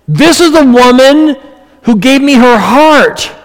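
a hint of Jesus pleading for the woman; Jesus defending her. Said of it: "And Jesus says".

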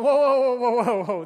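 loud, rapid-fire protest; acted-out shout of protest; alarmed objection. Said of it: "And they're going".

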